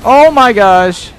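loud slow startled cry; startled shout. Real, not acted. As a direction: cry out in shock.

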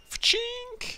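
playful sound effect; mock sword sound. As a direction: make the blade noise with your mouth.

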